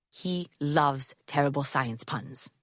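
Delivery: put-on slow, lightly accented old man; slow, fond German accent. A narrator's reading. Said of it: "Johann said".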